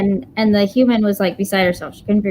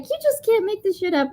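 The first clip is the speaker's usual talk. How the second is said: amazed disbelief; 11 semitones higher, 4 dB quieter than usual. Retold or imitated. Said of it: "I was like".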